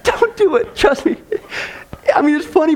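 ordinary talk, nothing marked

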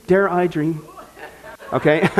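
chuckling through the words; laughing. Real, not acted. Host repeating the joke, laughing. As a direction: let the laugh break in.

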